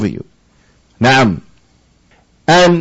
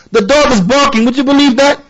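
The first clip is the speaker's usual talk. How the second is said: mock-astonished, pitched higher; mock amazement; 7 semitones higher than usual. Teasing someone amazed by a barking dog, in mock astonishment. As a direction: ham up wonder at the obvious. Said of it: "No one looks at a dog barking and says".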